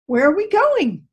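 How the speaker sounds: the donkey asks again in a rising panic; frightened. A narrator's reading. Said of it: "The donkey was frightened".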